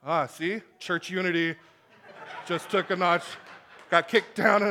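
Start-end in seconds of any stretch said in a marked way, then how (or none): none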